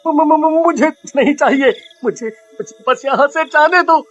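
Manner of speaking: stammering, panicked pleading; frightened begging. Acted. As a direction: beg for your life. Man begs serpent for mercy. (गिड़गिड़ाते हुए)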